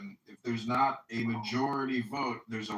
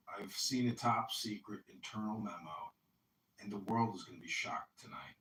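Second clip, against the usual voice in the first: hushed, secretive tone; conspiratorial whisper; 6 semitones lower, 7 dB quieter than usual. Pretend. Conspiratorial secret memo talk.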